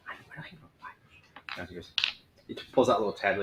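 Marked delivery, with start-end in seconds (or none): 0.1-1.6 whispered mutter. Acted.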